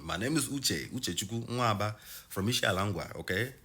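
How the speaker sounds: light Nigerian accent. Shown as a demonstration. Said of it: "I can talk with a Nigerian accent".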